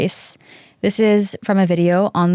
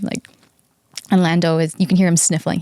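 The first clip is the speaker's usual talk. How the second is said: ordinary talk, nothing marked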